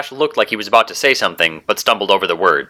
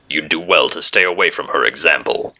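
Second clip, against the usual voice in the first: low, gruff hero warning; grunted; 5 semitones lower than usual. A narrator's reading. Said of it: "he grunted".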